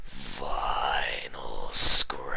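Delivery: low, very slow whispered monster wail; ghastly wail. A narrator's reading. The grotesque figure calling Vinyl, slow ghastly whisper. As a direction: draw the name out like a ghoul. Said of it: "it wailed".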